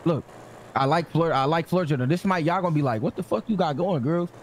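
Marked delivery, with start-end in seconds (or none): none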